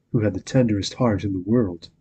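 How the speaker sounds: plain reading voice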